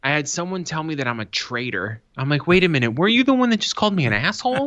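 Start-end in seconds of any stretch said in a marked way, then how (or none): 2.5-4.7 high, fast, indignant comeback; retorting to a heckler, mock outrage; indignant disbelief. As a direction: shoot it back high and quick in mock outrage.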